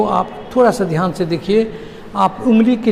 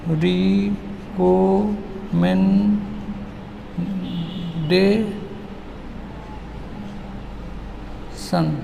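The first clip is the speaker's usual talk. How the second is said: "Recommendation" softly, five long-held beats far apart; extremely split syllables; 7 dB quieter, much slower than usual. Shown as a demonstration. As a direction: softly leave a long pause after each syllable of "recommendation". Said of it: "क्या टुकड़ा होगा".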